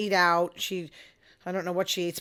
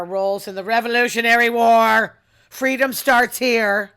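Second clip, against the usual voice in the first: mock-grand proclamation; 8 dB louder than usual. Massachusetts local hailing the Revolutionary War, loud proclamation. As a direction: proclaim it loudly to the crowd.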